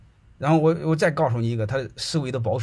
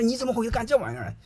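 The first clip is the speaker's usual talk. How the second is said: incredulous question; 11 semitones higher than usual. Retold or imitated. Principal asks welder in disbelief.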